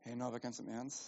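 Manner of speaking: low, hushed tempting voice; insinuating whisperer. Acted. Tempter coaxing the preacher into sin, low and hushed. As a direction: murmur it softly, like a secret in the ear. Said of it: "flüstert mir ins Ohr und sagt so".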